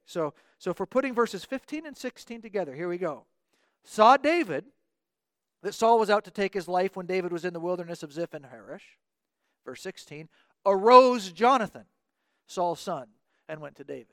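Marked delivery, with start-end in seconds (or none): 3.8-14.1 SAW and AROSE hit loud at the start of each clause; word-order emphasis. Shown as a demonstration. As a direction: read it woodenly, leaning hard on "Saw" and "Arose". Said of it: "let me just woodenly put this together".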